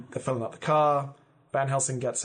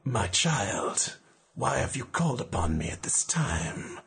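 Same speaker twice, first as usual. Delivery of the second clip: ancient spirit voice; 2 semitones lower, slower than usual. Acted. Spirit Papa Legba speaks through Genevieve.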